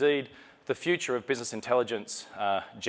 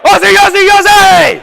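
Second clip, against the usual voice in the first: full-volume high chant, belted to the crowd; rally the room with a huge, high shout; Australian war cry; 19 dB louder, slower than usual. Pretend. Speaker leading the audience in a war cry, loud high shouting. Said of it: "we're gonna do the Australian war cry".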